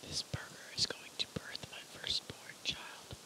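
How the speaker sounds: soft ASMR whisper. Real, not acted. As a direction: whisper close to the mic. ASMR eating video.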